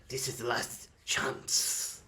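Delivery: slow, hushed last warning; ominous slow whisper. Acted. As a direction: drag it out in a menacing whisper. Kobold's final warning.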